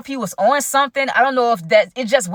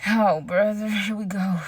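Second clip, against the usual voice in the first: weary oh brother; 5 dB quieter than usual. Real, not acted. Narrator sensing trouble at the karaoke party, weary dread. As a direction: sigh it like you can see trouble coming.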